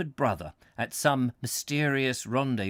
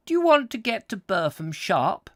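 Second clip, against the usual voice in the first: put-on a small country boy, pitched much higher and louder; high boy's voice; 8 semitones higher, 5 dB louder than usual. A narrator's reading. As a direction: ask it as an eager little urchin, up high. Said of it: "said he in a very high voice".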